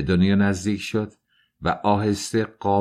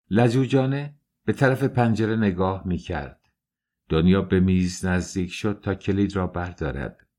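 usual speaking voice

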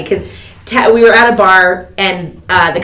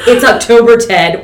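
louder, snappier mock retort; condescending blurt; 3 semitones higher, 6 dB louder than usual. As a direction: blurt it out louder, talking down to Ted. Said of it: "was the first thing ... without thinking came out".

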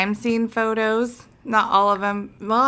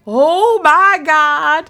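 slow, high, loud gasp of delight; starstruck; 7 semitones higher, 7 dB louder, much slower than usual. Retold or imitated. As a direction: drag it out in amazement. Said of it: "And I'm like".

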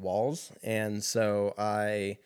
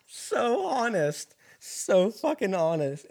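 laughing; 10 semitones higher, slower than usual. Real, not acted. Marveling at dad's honesty.

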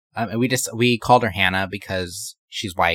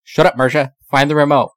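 loud snapping command; angry bossy shout; 4 semitones higher, 8 dB louder than usual. Retold or imitated. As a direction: snap at her loudly. Ike snapping at friend.

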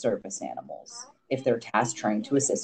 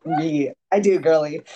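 louder, cheeky, playful quip; sassy comeback; 4 semitones higher, 5 dB louder than usual. Retold or imitated. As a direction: tease her with a sassy boast. Nurse compliments tattoos.

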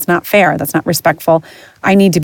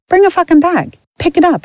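exasperated outburst; 12 semitones higher than usual. Real, not acted. Ranting at careless owners.

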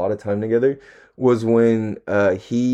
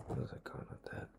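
breathy whisper to himself; voiceless aside under the breath; 26 dB quieter, faster than usual. Real, not acted. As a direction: drop to a whisper, thinking aloud. Muttering to himself mid-thought.

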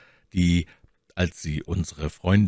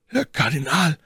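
astonished; 9 semitones higher than usual. A narrator's reading. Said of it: "rief der Musketier verwundert".

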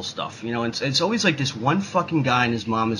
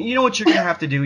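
usual speaking voice

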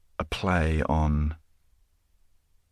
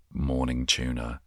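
normal talking voice

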